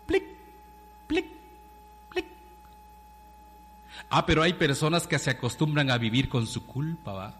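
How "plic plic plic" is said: short clipped "plic" drops with pauses; dripping water sound. Retold or imitated. Preacher imitating water dripping on stone, drop by drop. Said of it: "una gota de agua sobre una piedra que hace plic".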